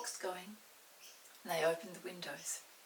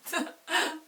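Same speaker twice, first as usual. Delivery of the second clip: laugh; 9 semitones higher, 10 dB louder than usual. A narrator's reading. Children laugh at the story.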